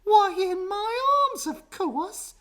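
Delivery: very high, loud, slow sing-song; brisk monkey mother. A narrator's reading. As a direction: chirp the obvious answer.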